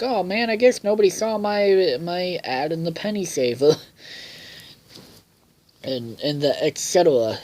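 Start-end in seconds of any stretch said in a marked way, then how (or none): 0.0-3.8 high hammed-up dejected dad; mock Bob. Bob lamenting his unseen ad, high mock dejection. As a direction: sigh it out like a let-down dad. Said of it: "Bob was like".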